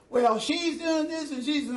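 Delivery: put-on high, childish protest; lightly voiced boy making excuses; child's whiny excuse. Son's excuse voiced by father.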